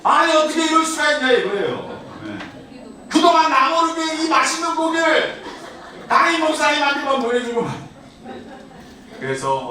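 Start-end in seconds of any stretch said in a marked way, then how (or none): 0.0-1.3 put-on high, loud childish indignation; mock outrage. Boy indignant that the meat went to the pastor, high and loud. 3.1-7.7 high, loud laughing complaint; mock grievance. Boy grumbling that the pastor got the meat, high and laughing.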